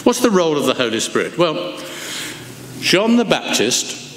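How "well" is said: normal talking voice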